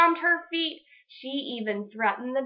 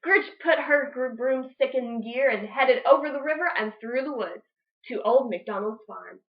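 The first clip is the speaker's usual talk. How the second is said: ordinary delivery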